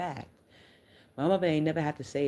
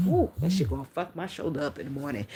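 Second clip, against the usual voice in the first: somebody's auntie. Real, not acted. Woman fretting over her sore shoulder, auntie-like grumble. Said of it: "I sound like somebody auntie".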